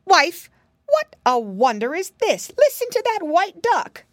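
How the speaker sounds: loud, high astonished call. A narrator's reading. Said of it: "called to the witch".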